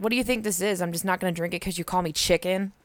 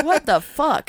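mock disbelief; 8 semitones higher, 5 dB louder, slower than usual. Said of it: "I'm just like".

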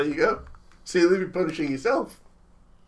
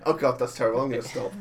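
usual speaking voice

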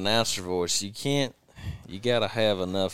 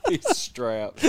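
laughing, high and bright; cracking up mid-line. Real, not acted.

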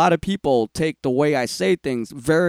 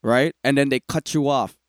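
usual speaking voice

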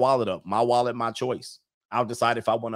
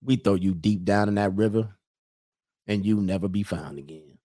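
lower-pitched, menacing Mississippi lawman; Mississippi cop accent; 3 semitones lower than usual. Acted. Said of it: "That's how they do you".

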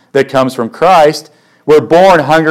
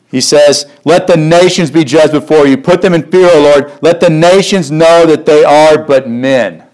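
raised, fervent reading of the prayer; prayed scripture. Acted. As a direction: call it out as a plea to God. Reads prayer of Psalm nine.

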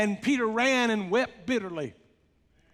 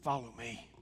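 much softer, lower, hushed call; whispered; 10 semitones lower, 12 dB quieter than usual. A narrator's reading. Jesus softly calling Peter back by the sea, hushed. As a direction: breathe it gently like a secret. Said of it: "he whispered to him".

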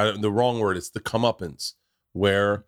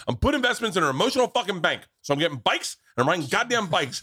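higher, worked-up rant about bikes; retold heated rant; 8 semitones higher than usual. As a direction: build the dad's indignant tirade. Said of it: "calm down".

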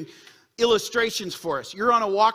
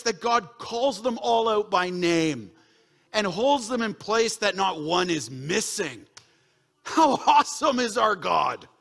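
slow emphatic praise; slower than usual. Acted.

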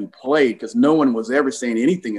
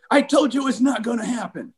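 upset coach; 10 semitones higher than usual. Retold or imitated. Coach shutting down a player's repeated question in a meeting, upset. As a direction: act out a coach losing patience. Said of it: "he kinda got upset".

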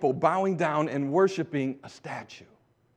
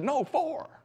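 triumphant answer; 13 semitones higher than usual. Retold or imitated.

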